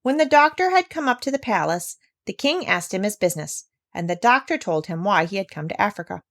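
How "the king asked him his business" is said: ordinary delivery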